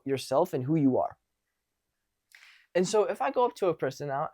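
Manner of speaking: ordinary delivery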